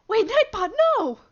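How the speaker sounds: high, loud cry of disbelief; shocked shout. Real, not acted. Streamer shouting at the chat bot in shock. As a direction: yell it like the bot just betrayed you.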